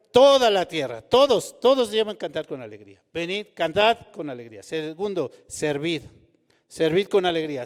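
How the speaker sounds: loud, high, driving sermon voice; fervent preaching. Acted. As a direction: preach it out to the whole congregation. Preaching at full force. (predicación fervorosa y fuerte)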